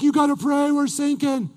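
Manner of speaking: high, loud mock-panicked shout; panicked yell. Captain to Jonah on the sinking boat, acted panic. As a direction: shake a sleeper awake with your voice.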